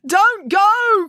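high loud desperate cry; Binky crying out to Friday. Acted. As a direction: call out begging her to stay. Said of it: "cried Binky".

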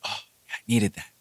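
hushed, breath-out relief; soft retold relief after breathing; relieved exhale. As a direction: let it out on a sigh. Acts relief after deep breath.

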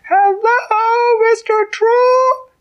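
very high, loud drawn-out call; shouting loud. A narrator's reading. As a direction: call into the troll's ear as loud as you can. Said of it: "she shouted as loud as she could".

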